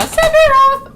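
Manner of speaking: high, loud belt of the name; hammed-up dramatic holler; mock fan cry. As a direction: cry it out like an over-keen fan.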